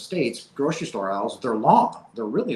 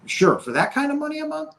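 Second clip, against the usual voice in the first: excited disbelief; 3 dB louder than usual. Retold or imitated. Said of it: "I, you know".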